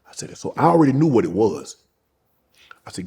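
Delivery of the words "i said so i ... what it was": ordinary talk, nothing marked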